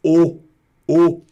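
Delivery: a loud, isolated "O". Shown as a demonstration. Teacher shows A plus U becoming O, "O" loud and isolated. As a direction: say "O" as one pure, punched vowel. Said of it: "A mais U vira O".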